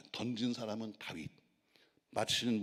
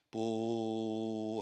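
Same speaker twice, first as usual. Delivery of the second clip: long, slow, drawn-out mock trumpet blast; a stretched-out mouth trumpet; 3 dB louder than usual. Preacher imitating the war trumpet call with a drawn-out blast. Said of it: "나팔을 불어요".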